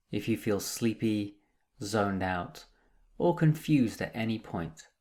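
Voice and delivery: calm, measured meditation guide with long pauses; guided meditation. Acted. Meditation teacher guiding listeners through breath and body, calm and unhurried. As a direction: lead them gently into stillness, leaving space between phrases.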